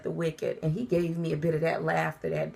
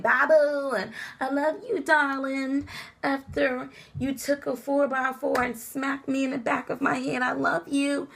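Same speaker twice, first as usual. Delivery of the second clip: high, gushing mock love talk; mock sweetheart; 7 semitones higher than usual. Wife mocking affection for her ex-husband, high and gushing. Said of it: "I'm not gonna be like".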